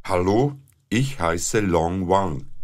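normal talking voice

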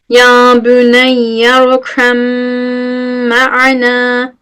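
slow recitation of Ya büneyye rukhem; Quranic recitation. Shown as a demonstration. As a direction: chant the verse slowly for the class. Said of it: "hadi gelin söyleyelim".

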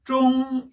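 "쫑" high, level and held; first tone. Shown as a demonstration. Teacher answering the first syllable, "쫑" high level tone. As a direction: hold "쫑" steady on a high note. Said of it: "앞 글자는 일 성이니까".

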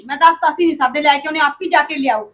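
high, loud demanding questions; angry shouting. Acted. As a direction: demand answers at full voice. Sister shouting in family quarrel. (ਗੁੱਸੇ ਵਿੱਚ ਉੱਚੀ ਸਵਾਲ)

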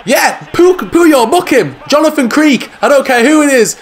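high, loud protest at the referee; worked-up shout for a card; indignant yelling. Real, not acted. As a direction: demand the booking. Yelling at referee after foul.

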